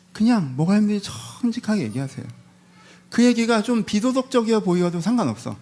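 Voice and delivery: fervent sermon urging honesty; preaching. Acted. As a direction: press the congregation to be honest. Preaching at rising force.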